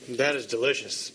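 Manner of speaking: talking through a mouthful; mouth full. Real, not acted. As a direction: speak around a bite of food. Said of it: "I'm talking with my mouth full".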